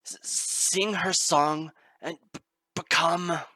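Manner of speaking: loud, slow, breaking on the first sounds; straining stutter. Acted. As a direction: fight to force out "sing" and "become". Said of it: "she grimaced".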